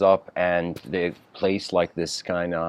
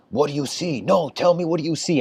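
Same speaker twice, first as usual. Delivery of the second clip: higher, insistent teacher's voice; pressing master archer; 8 semitones higher, faster than usual. Retold or imitated. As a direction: push him for an answer, pitch raised.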